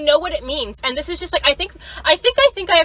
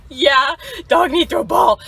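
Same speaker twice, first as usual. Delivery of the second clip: loud dopey dog voice; 3 dB louder than usual. Retold or imitated. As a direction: shout it like an excited dog. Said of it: "And I'll be like".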